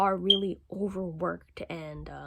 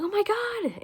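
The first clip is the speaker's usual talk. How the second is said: high, sudden "oh my God" in a mock shock; shocked gasp; 13 semitones higher, 4 dB louder, slower than usual. Worker fleeing zombies, in a high mock shock. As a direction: yelp it out as if you just saw zombies.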